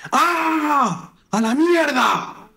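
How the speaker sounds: very high, drawn-out yell; full scream. Acted. Son screaming in his bedroom without thinking, full-throated yell. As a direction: let it all out in one long scream. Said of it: "voy y grito".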